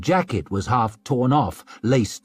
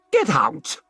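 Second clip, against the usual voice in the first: snapped; 4 semitones higher than usual. A narrator's reading. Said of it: "snapped Lisbeth, furious".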